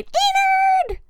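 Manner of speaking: high-pitched yelled name across the yard; calling out a name. Retold or imitated. Grandmother yells her husband's name across the yard, high call. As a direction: call him from wherever you are, high and drawn out. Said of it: "she'd just yell it across".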